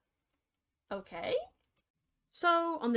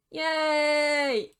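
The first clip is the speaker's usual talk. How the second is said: loud, put-on cheer; mock cheer; 3 semitones higher, 5 dB louder than usual. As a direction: cheer with fake enthusiasm. Sarcastic cheer.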